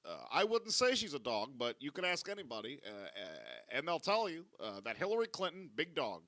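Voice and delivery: halting presidential speech, phrases falling in pitch; Barack Obama. Acted. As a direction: stretch out the uhs between measured phrases. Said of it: "but in Barack Obama's voice".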